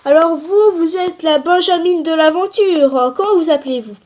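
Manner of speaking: a kid playing a quiz host; game show host. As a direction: open the show like a presenter. Child plays TV game show host.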